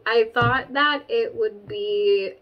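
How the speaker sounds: ordinary talk, nothing marked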